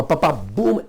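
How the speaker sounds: ordinary delivery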